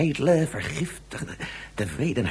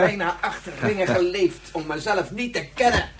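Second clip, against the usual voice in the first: anguished outcry; 8 semitones higher, 4 dB louder than usual. Acted. Scientist's anguished self-reproach.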